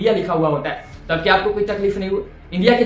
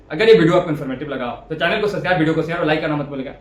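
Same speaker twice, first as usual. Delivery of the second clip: quick like-and-subscribe patter; fast, routine YouTube outro; rattled-off sign-off; 2 semitones lower, faster than usual. Real, not acted. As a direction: race through the channel plug.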